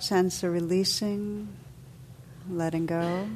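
calm, unhurried meditation voice with long pauses; guided meditation. Real, not acted. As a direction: guide listeners gently into stillness, letting phrases settle.

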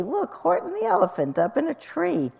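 high-pitched, gleeful mock shout; delighted teasing crowd, lightly voiced; gleeful jeering. A narrator's reading. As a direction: call it out high, without real volume. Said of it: "they shouted with glee".

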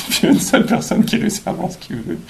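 high, breaking into laughter while talking; laughing. Real, not acted. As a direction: barely get it out through laughter. Cracks up at own joke. (en riant)

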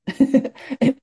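a short high laugh at herself; light self-deprecating chuckle; brief real laugh. Real, not acted. Teacher to the room, laughing at her own flaws.

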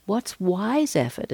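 ordinary delivery

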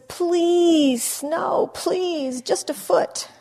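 high, loud pleading; a child's desperate plea; begging. Retold or imitated. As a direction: beg on your knees for snow. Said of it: "gets down on his knees and he says".

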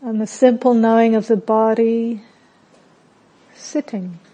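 calm meditation voice with long pauses; unhurried, settled guidance, softening at phrase ends; guided meditation. Real, not acted. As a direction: guide them gently inward, phrase by phrase.

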